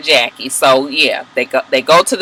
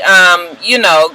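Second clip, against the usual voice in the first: ordinary delivery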